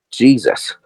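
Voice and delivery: usual speaking voice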